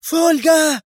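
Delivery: very high, frantic summons; agitated scream. A narrator's reading. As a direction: scream for the guard. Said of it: "ثم صرخت في هياج".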